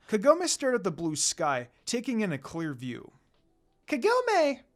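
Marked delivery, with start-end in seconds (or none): 3.9-4.6 high, loud call across a field. A narrator's reading. Shippo calling to Kagome from afar, a loud call. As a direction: call her name from a distance.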